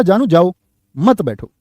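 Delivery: group मत with बैठो, pausing after जाओ; pause after जाओ. Shown as a demonstration. Teacher reading the first meaning to students, pause after जाओ. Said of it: "अब इसमें अल्पविराम नहीं है".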